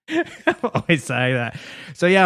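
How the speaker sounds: ordinary talk, nothing marked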